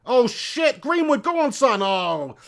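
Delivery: loud, high football cheer; excited shout. Real, not acted. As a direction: urge the player on, pitched high and loud. Live football watching, urging player on.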